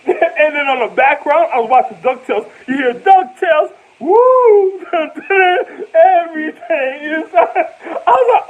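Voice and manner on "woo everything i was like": crying with laughter; helpless laughter; laughing hard. Real, not acted. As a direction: lose it laughing mid-word. Laughing while recalling.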